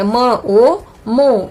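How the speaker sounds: म, then ओ, then joined मो, slowly; syllable blending. Shown as a demonstration. Teacher adding the matra, slow blending of म ओ मो. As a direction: sound out म ओ मो in separate beats. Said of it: "इस तरह से".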